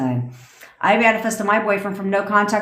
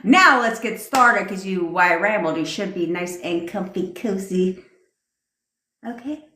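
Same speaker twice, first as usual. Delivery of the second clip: guided meditation. Acted. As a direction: guide them into calm visualization.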